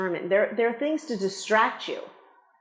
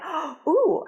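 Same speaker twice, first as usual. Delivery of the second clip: high, delighted "oh, ooh"; mock delight; 7 semitones higher than usual. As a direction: act out casting people suddenly taken with an actor. Imitating pleased casting people.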